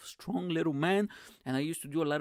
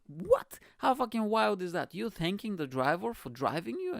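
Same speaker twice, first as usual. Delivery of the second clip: shocked disbelief; 7 semitones higher than usual. Retold or imitated. Retelling his shock at passengers thanking the driver, high and incredulous. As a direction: react like it's the wildest thing ever. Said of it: "And I was like".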